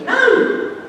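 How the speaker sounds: high, indignant no; a mock protest, jumping way up; surprised no. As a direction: mom objecting to her child, mock indignant. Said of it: "She goes".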